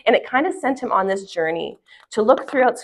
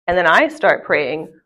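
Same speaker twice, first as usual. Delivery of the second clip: usual speaking voice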